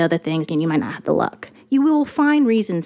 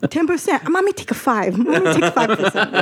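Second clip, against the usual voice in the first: higher, mock Chinese-accented mom voice; imitating Chinese mom; 6 semitones higher than usual. Mom pitching her daughter to an agent, higher put-on accent. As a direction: play the pushy immigrant mom.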